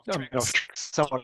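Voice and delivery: ordinary talk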